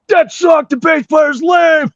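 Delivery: high, slow, loud holler; imitated long-distance jeer; heckling from afar. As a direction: yell it across the whole venue. Heckler calling from back of venue.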